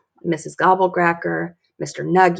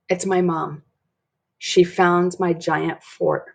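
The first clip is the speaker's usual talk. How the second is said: ordinary delivery, nothing marked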